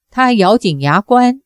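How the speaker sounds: ordinary delivery, nothing marked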